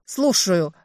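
loud, curt bark; barked reply. A narrator's reading. Woman answering the unknown caller, barked reply. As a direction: snap back at the caller. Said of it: "рявкнула в ответ".

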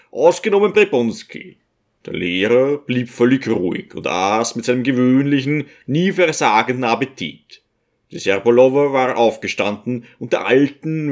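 ordinary delivery

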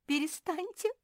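high, thin-pitched tearful plea; tearful cry. A narrator's reading. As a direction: beg them to stop, close to tears. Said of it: "заплакала Жози".

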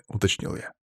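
plain reading voice

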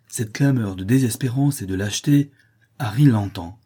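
ordinary delivery, nothing marked

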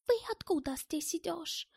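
high-pitched foreigner's question; excitable German character. A narrator's reading. German surprised to meet his acquaintance, excitable high voice. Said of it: "громко говорил, привлекая внимание прохожих".

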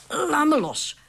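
gruff snapped order; irritated growl. Acted. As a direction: let go of me, now. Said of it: "grauwde de burgemeester".

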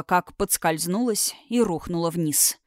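plain reading voice

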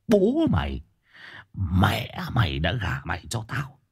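slow whispered villain threat. Shown as a demonstration. Villain demo.

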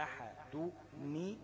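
أحدني stretched very slowly, the nun clearly voiced; slow, drawn out أحدني, stress on the kasra of the nun; linking demo. Shown as a demonstration. Teacher shows students how the nun is joined, very slowly. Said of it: "فتبقى إيه؟".

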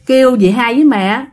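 ordinary delivery

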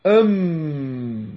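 "أم" with a long humming m through the nose; nasal mim demo. Shown as a demonstration. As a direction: hold the m of "أم" very long. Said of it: "تخرج من الأنف".

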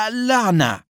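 high, drawn cry of frustration; shouted curse. A narrator's reading. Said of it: "جاء دور أدهم ليصيح". (صيحة لعن بنبرة مرتفعة)

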